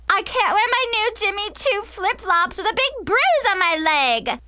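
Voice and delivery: put-on high-pitched wailing girl; shrieking drama queen. Bruised girl complains to Mom and her friend Jess, shrieking. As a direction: wail melodramatically over a tiny injury. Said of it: "Mackenzie shrieked".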